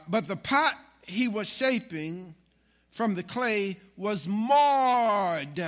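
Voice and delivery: slow, stretched, swelling to a loud cry on marred; building emphasis. Acted. Pastor stresses the marred pot to the congregation, slow, building to a shout. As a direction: drag it out and cry out the word marred. Reading verse with rising force.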